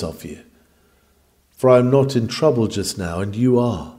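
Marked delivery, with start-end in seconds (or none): none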